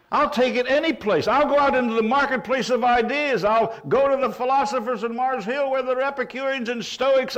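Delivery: high, loud, bold preaching voice; preaching at full force. Acted. Preacher voicing Paul to the crowd, loud and defiant. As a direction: proclaim it like Paul before philosophers.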